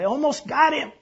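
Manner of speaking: high, loud, straining shout; yelled protest. A narrator's reading. Swimmer pleads to keep trying the rescue, yelling. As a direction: insist at a yell from the rapids. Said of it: "yelled Wally".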